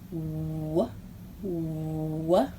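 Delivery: a quiet, drawn "W-" sound; soft letter sound "W-". Shown as a demonstration. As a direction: push out the "w-" softly. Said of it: "Can you make his sound?".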